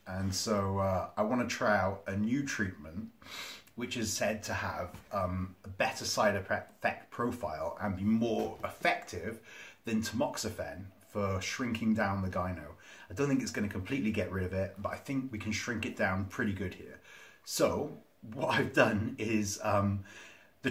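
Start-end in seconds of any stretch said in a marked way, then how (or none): none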